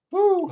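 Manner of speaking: high, loud whoop; excited whoop. Real, not acted. Streamer looking forward to a friend's stream, whooping. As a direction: shout it out with joy.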